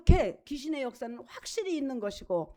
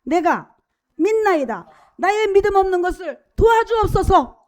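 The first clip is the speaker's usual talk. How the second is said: loud, raised cry of faith; 5 semitones higher, 8 dB louder than usual. Acted. Preacher reads the father's cry to Jesus, shouted plea. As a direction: call it out high and loud, imploring. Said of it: "소리를 질러 가로되".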